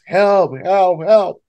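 high, loud cries for help; calling for help. Retold or imitated. Swimmer waves at distant shore, shouting for help. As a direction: call out across the water. Waving hands, calling for rescue.

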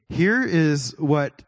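ordinary talk

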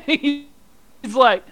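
loud, high, giggling delivery; can barely get it out for laughing; laughing through it. Retold or imitated. Telling friends the doll arm story, laughing loudly.

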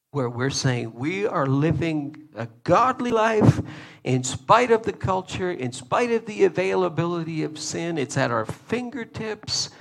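usual speaking voice

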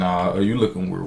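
ordinary talk

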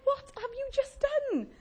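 high, drawn-out exclamation of dismay; mock appalled. Preacher re-enacts horror at the office uproar, mock appalled.

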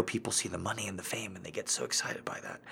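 quick whispered aside; whispering. Real, not acted. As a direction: rush it out under your breath. Aside about fame, whispered.

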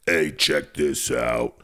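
low, slow sinister taunt; hammed-up low demon tease; slow mock demon. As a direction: drag it out like a gloating demon. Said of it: "You're gonna watch".

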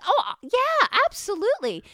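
high, loud, overeager yes; mock eager agreement. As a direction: parody someone agreeing far too easily. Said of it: "for those who are like".

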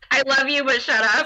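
playfully passive-aggressive brush-off; sugar-coat a telling-off in jest. Pretend. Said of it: "We'll do it passive aggressively".

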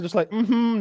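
usual speaking voice

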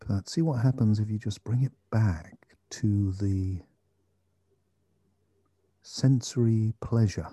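slow, calm meditation guide; guided meditation. Acted. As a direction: gently guide attention to the breath.

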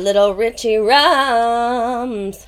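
slow, stretched and teasing; playful drawn-out nickname. Pretend. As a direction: savour the nickname slowly.

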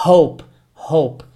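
"hope" with the h of a laugh; aspirated H. Shown as a demonstration. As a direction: say "hope" twice, airy h up front. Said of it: "Como você fala ha de rir".

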